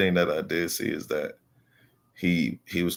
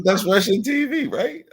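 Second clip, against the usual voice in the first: innocent checking; 14 semitones higher than usual. Retold or imitated. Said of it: "I wanted to make sure I was correct".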